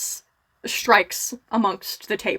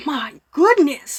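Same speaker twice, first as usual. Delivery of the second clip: gasp, then drawn-out higher exclamation; big shocked reaction; 5 semitones higher, 4 dB louder, slower than usual. Acted. Brother sees the others upset at the table, gasping in mock shock. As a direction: react big, slowly stretching the words. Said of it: "also reacting big".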